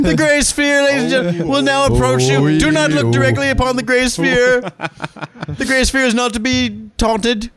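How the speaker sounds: high, slow, solemn prophetic warning; mock biblical prophet. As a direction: preach doom like an old prophet. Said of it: "ridiculous, like, it is, like, some satanic ritual".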